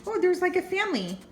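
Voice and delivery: ordinary delivery, nothing marked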